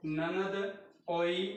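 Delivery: ननद ओई stretched out slowly; slow dictation. Shown as a demonstration. Teacher splitting नंदोई into parts for students, slow. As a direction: lay out each part slowly. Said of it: "dictating morphemes".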